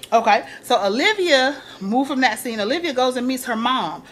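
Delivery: ordinary talk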